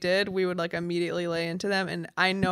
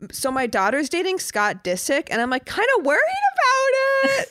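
mock worry climbing into a high squeal; rising, gleeful put-on fretting; barely hidden glee; 9 semitones higher, 4 dB louder than usual. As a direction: imitate a gloating mom feigning concern. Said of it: "She's so excited".

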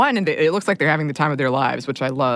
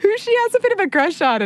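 high-pitched, talking through laughter; laughing. Real, not acted. Daughter tells on mom's crush, laughing. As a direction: still cracking up while explaining. Cracking up over mom's reaction.

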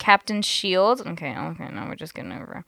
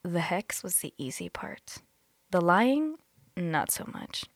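whispering; 2 semitones lower, 9 dB quieter than usual. Pretend. Reading quote dramatically, whispered.

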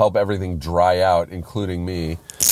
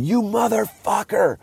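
very high, strained screaming rage; furious retold yell. Driver choking the friend who puked again, furious high yell. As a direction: scream it as if throttling him. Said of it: "I was not as forgiving".

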